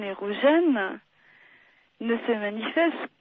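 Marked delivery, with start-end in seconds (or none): none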